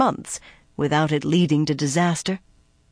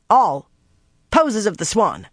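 raised, abrupt order to performers; barked command; 4 semitones higher, 4 dB louder than usual. A narrator's reading. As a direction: call out the order sharply. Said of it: "Alwyn barked again".

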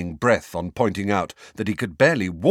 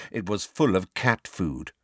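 ordinary delivery, nothing marked

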